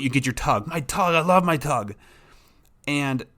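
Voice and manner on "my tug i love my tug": a light, higher put-on voice of a tug-obsessed dog; dog's voice. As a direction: voice the dog, besotted with its toy. Voicing the dog's love of tug.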